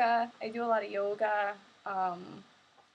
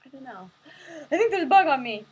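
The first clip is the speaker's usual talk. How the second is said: ordinary talk, nothing marked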